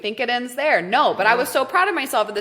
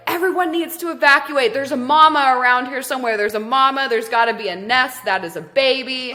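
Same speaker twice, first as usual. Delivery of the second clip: higher, louder urgent warning voice; acted alarm; 4 semitones higher, 5 dB louder than usual. Strangers warning of a mother snake nearby, acted alarm. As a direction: imitate onlookers raising the alarm. Onlookers urging evacuation in story.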